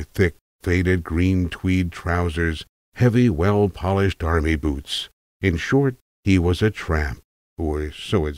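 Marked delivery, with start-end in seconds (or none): none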